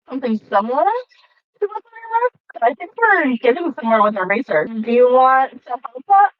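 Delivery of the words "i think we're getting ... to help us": ordinary talk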